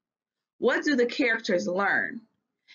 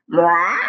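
kiss sound; 4 semitones lower, 10 dB louder than usual. Acted. Sound effect read into story.

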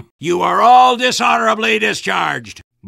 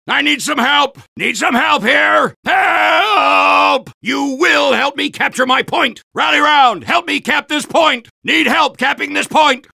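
calling for help; 6 semitones higher than usual. Acted. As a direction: yell across the battlefield for backup.

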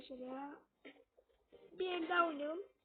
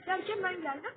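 ordinary talk, nothing marked

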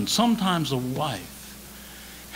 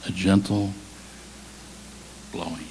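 deep, gentle, dying breeze of a voice; still small voice; 8 semitones lower, 6 dB quieter than usual. Acted. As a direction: sink down to a hush on the last word. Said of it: "in a still small voice".

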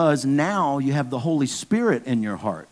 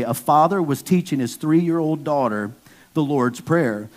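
ordinary talk

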